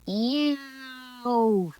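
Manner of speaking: high, distant bird squawk; peacock cry. Acted. Peacock answering the girl, high squawk. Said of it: "said the peacock".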